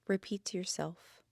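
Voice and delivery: gentle Yoga Nidra guide voice; guided meditation. Acted. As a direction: ease the listener into stillness.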